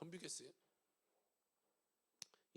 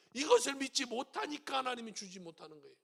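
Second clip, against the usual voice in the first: ordinary delivery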